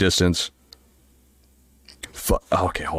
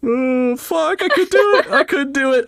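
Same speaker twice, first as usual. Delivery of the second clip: much higher, louder, giddy; excited squeal; 4 dB louder than usual. Real, not acted. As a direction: squeal with real relief. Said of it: "I chickened out".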